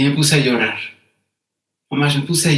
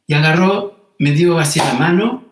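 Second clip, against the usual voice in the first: ordinary talk, nothing marked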